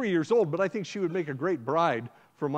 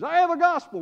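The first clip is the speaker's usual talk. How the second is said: loud ringing proclamation, much higher; proclaiming shout; 11 semitones higher, 8 dB louder than usual. Acted. Brother shouting at the girl's door, loud proclamation. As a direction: call it out through the door.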